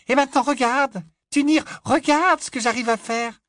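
proud, look what I can do; eager little girl. Acted. Little girl shows her sister a trick, high and eager.

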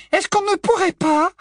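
very high, pleading mother voice; imploring mother. A narrator's reading. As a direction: Mrs Weasley begging Bill not to go. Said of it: "en jetant à Bill un regard implorant".

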